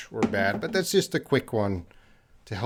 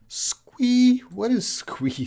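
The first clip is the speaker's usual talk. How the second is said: puzzled squeal; 12 semitones higher, much slower than usual. Shown as a demonstration. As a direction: try the odd word out in a squeal. Trying out unknown squeal word.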